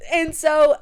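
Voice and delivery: ordinary talk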